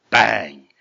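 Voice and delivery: sudden loud storybook bang; loud impact word. A narrator's reading. Big goat ramming the troll, loud punched bang. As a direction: punch it out like a blow landing.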